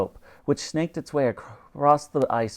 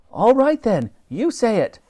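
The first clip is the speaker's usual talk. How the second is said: cheeky Elsie; 10 semitones higher, 5 dB louder than usual. A narrator's reading. Elsie cedes the order.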